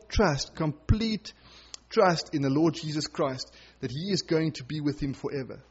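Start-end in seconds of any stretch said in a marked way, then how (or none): none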